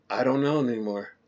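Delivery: soft, deflated give-up; defeated quiet. Retold or imitated. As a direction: let it sink, all energy gone. Defeated after confusing show.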